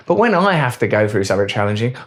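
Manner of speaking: ordinary talk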